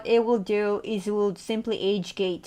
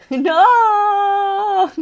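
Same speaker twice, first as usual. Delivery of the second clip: long, high, loud cry; laughing wail; 11 semitones higher, 10 dB louder than usual. Real, not acted. Streamer reacts to chat about ID, wailing. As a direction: draw it out into a big laughing yell.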